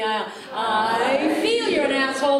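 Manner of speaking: slow, loud I feel with a sneer; mock-sincere therapy insult; mock therapy-speak. Shown as a demonstration. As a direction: drag out feel sweetly then insult. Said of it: "say it with me".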